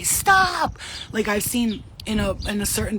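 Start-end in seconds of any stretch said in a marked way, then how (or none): 0.0-0.8 loud, high, drawn-out yell; retold shout. Interrupting a scene in another dimension, shouted. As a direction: shout it to halt everyone. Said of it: "until I do shit like say".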